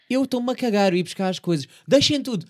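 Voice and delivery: high, loud, frantic; panicked shouting. Acted. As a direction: yell it like the building's burning. Acts herself in a fire panic. (grito em pânico)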